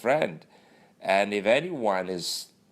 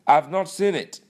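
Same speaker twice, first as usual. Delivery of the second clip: high, loud flat denial; imitated loud, high-pitched stonewall; defensive Lawal; 9 semitones higher, 7 dB louder than usual. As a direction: insist loudly, pitched up. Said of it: "He has answers".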